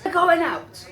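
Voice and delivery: high, loud tipsy declaration; retold loud announcement. Drunk friend telling hotel worker, loud declaration.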